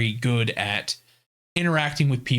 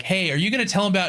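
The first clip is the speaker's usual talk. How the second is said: fast, higher Freya voice; mock Freya; 6 semitones higher, much faster than usual. Freya nudging him about the secret, quick higher voice. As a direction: rattle it off, nosy. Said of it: "Freya's like".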